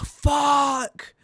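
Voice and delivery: high, sudden cursing yell; frustrated shout. Real, not acted. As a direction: blurt it out in frustration. Sudden game failure.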